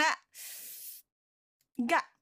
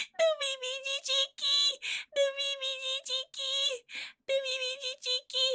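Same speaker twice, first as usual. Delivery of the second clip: slow, high, hammed-up rallying chant; slow high battle cry; 9 semitones higher, slower than usual. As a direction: chant it slowly like a war cry.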